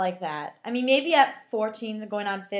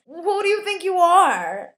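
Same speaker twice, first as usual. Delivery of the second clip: loud, high, drawn-out challenge; slow, hammed-up indignant outburst; mock outrage; 8 semitones higher, 8 dB louder, slower than usual. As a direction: scold them in exaggerated disbelief. Mock scolding of bat-swinging kids.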